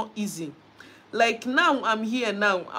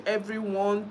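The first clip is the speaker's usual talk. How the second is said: ordinary talk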